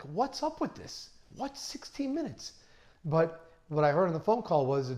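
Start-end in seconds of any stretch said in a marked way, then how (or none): 0.0-2.5 high, baffled mock disbelief, softer; replaying his own bewildered thought, pitch climbing; incredulous disbelief. Surgeon baffled by the oddly exact arrival time, incredulous. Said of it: "Now I'm wondering".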